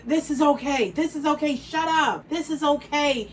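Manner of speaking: mock the people telling her to hush; mocking dismissive voice.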